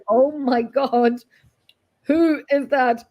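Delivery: high, slow, drawn-out mock shock; retold disbelief. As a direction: act out being aghast, stretching each word. Acts out her shock at recording.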